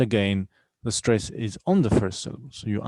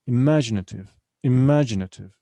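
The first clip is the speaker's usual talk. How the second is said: "Imaginative" with a louder "ma"; stress on second syllable; 5 dB louder than usual. Shown as a demonstration. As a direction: punch the MA in "imaginative". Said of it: "on the second syllable".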